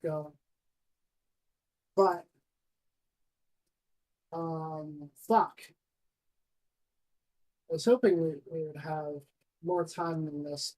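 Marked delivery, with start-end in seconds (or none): none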